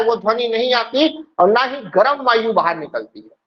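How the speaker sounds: usual speaking voice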